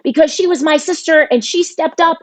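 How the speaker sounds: imitating an upset brother's outburst; retold angry outburst. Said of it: "he got really loud, and he's like".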